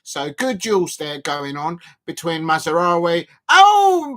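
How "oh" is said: sudden high, loud "oh"; excited outburst. Real, not acted. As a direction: blurt out a loud cry at the action. Sudden reaction to live match.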